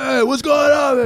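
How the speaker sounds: high mock shout; hammed-up angry yell; imitating angry Steve. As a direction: burst out as a rough alter ego. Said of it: "angry Steve was like".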